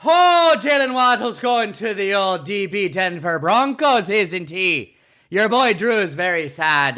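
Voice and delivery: lilting Irish brogue, starting high; Irish accent. Shown as a demonstration. Co-host teasing the roommate on request, playful Irish accent. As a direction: open high on a big Irish "Oh", then lilt along. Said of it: "You have a great Irish accent".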